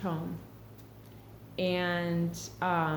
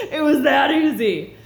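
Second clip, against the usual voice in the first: loud, laughing, much louder; 7 semitones higher, 11 dB louder than usual. Real, not acted. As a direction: shout it through real laughter. Laughing at her own realization.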